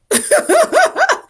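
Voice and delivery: squeaky high burst of laughter; high giggle. Real, not acted. Amused at her own joke, a high giggle. Laughing at herself over woke.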